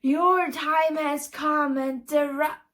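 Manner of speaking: put-on child villain prophesying doom; dastardly voice. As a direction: ham up the end of the world, slowing. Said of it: "a dastardly voice said".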